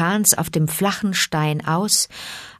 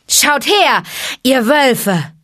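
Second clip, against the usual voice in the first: loud, higher call to the pack; 8 semitones higher, 7 dB louder, slower than usual. Acted. Mowgli calling the wolves to the rock, loud. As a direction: summon the wolves to look. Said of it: "rief Mowgli".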